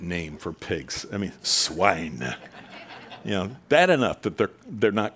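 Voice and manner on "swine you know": usual speaking voice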